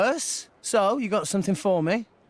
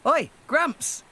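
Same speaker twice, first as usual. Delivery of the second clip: calling out; 6 semitones higher than usual. Acted. Calling out to grandfather.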